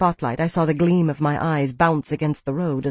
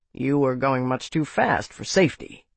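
lightly voiced male officer, lower pitch; a hint of a stern officer, pitched down; seeker officer; 4 semitones lower than usual. Seeker to driver stopped at roadside, lightly voiced low officer.

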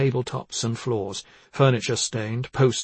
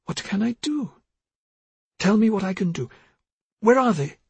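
high, pleading questions; growing desperation; 9 semitones higher than usual. A narrator's reading. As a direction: plead helplessly for an answer.

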